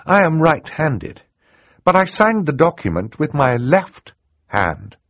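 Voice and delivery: put-on high, deliberate young girl's voice; explaining girl. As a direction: lay out the proof point by point. Girl heroine voiced in narration.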